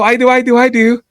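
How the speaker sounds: high, eager hand-raising cry; mock-eager high voice. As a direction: pipe up keenly like a kid volunteering. Eager volunteering in the meeting.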